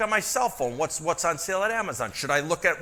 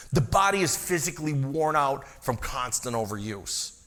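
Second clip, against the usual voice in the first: ordinary talk, nothing marked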